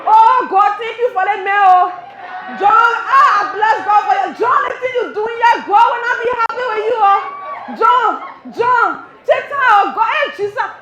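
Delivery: loud, high Liberian lover shouting praise; loud Liberian lover praising God. Retold or imitated. Comic imitating a Liberian lover crying out to God over John. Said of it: "then you putting God inside".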